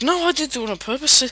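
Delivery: acted protest. Devon defends himself in the story.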